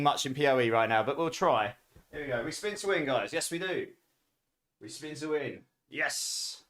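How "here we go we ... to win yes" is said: hushed spin-to-win chant; quiet. Real, not acted. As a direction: say it almost under your breath.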